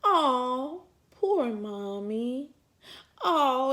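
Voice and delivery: slow, lightly voiced child's sympathy; son's sympathy. Son comforting hurt mommy, slow and tender. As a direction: coo like a little boy comforting mom. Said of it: "he always just".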